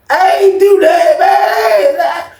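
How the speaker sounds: high, loud, slow protesting whine; panicked denial. Retold or imitated. Caught cheater denies it to the angry player, high and slow. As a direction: plead innocence in a squeal.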